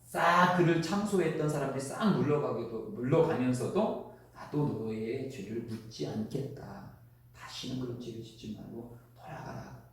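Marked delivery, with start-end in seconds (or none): 4.3-9.8 gentle forgiveness. Retold or imitated. Jesus forgiving the adulterous woman.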